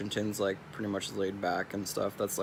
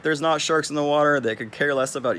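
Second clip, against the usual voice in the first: louder, firm, correcting; retold loud retort; 6 semitones higher, 9 dB louder than usual. Answering the wife's shark fear, louder and firm. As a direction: set her straight, loudly. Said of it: "I'm like".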